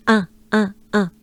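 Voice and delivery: un as a short nasal French vowel. Shown as a demonstration. Teacher drilling the added sound, French nasal un. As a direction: repeat un nasally, no n heard. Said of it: "you add the un sound".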